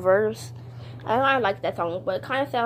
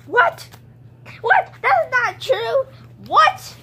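much higher, loud outraged disbelief; shocked what; 11 semitones higher, 6 dB louder, much slower than usual. Acted. Toy accused of kissing Precious, shocked denial. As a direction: squeal it like you can't believe it.

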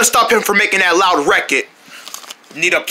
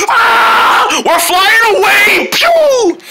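high, loud, drawn-out shout; pretend screaming; 11 semitones higher, 4 dB louder, much slower than usual. Characters flying away, high loud screaming. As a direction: shriek it as if blasted skyward.

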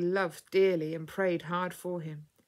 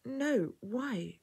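higher, questioning puzzlement; puzzled, starting high and easing down; 6 semitones higher than usual. A narrator's reading. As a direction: answer as if baffled by the idea. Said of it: "she asked, puzzled".

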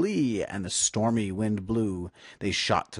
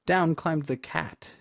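usual speaking voice

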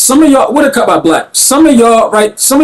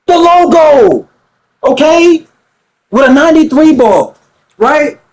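hyped; 7 semitones higher than usual. Real, not acted. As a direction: stretch it out, still amazed. Hyped over logo three.

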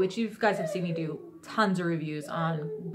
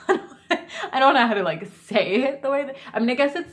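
laughing through the words, louder and higher; giggling at the silly spelling; 6 semitones higher, 6 dB louder than usual. Real, not acted. Vlogger laughing to viewers about the cake's misspelling.

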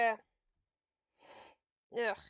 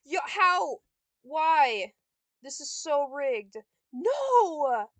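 outraged yell; 2 semitones higher, 11 dB louder, slower than usual. Real, not acted. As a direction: yell at the rigged game. Outraged at the game.

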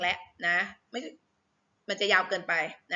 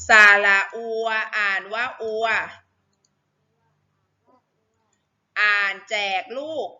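spelling drill; 4 dB louder than usual. Shown as a demonstration. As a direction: sound out each syllable like a reading teacher. Leads a letter-reading drill.

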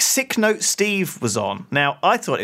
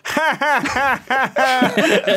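imitating a lone slow "ha, ha" laugh from the back; spaced-out fake laugh; slow mock laugh; 9 semitones higher, much slower than usual. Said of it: "one guy at the back goes".

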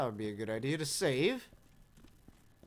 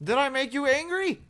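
loud taunt; 9 semitones higher, 7 dB louder than usual. Real, not acted. Taunting a game enemy.

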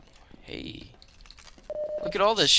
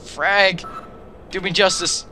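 excited; 7 semitones higher, 4 dB louder than usual. Real, not acted. Throwing a grenade excitedly.

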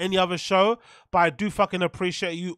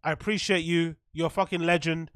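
normal talking voice

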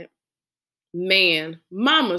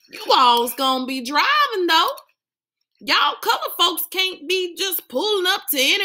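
mock a backwoods Southerner, teasing the kids; hillbilly accent; 11 semitones higher than usual. Dad teasing his kids with a put-on hillbilly accent. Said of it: "Dad did an imitation of a hillbilly accent".

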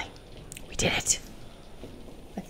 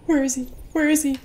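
scared; 5 semitones higher, 7 dB louder, faster than usual. Real, not acted. Scared, searching in horror game.

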